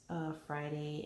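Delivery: usual speaking voice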